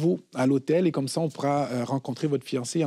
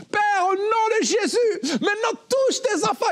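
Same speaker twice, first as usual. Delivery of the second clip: prière hurlée très haut, lente, avec des sanglots; prière criée à tue-tête. Retold or imitated. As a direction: implore Dieu en criant de toutes tes forces. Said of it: "prie à tue-tête pour enterrer la musique". (prière criée très aiguë pour le couple, par-dessus la musique)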